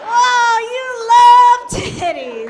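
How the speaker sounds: slow, loud, singing it out; loud sing-song tease; sing-song delight. Real, not acted. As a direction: croon it at the crowd, laughing. Mock marveling at crowd.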